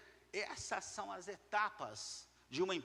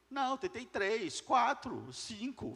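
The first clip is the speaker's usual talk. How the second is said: higher, louder, slow mimicked protest; mock excuse; 6 semitones higher, 6 dB louder, slower than usual. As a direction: count off feeble attempts in a raised mimicking voice. Mimics person who tried little.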